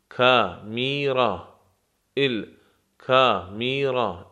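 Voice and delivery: الکامیرا drawn out syllable by syllable, very slow; الکامیرا, very slow and careful; very slow model word. Shown as a demonstration. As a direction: stretch الکامیرا out for learners. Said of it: "teacher stretches the word".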